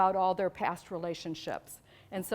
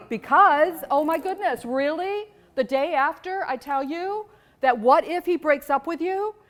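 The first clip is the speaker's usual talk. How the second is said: excited outburst; 6 semitones higher, 8 dB louder than usual. Real, not acted. Excited rant after breakup news.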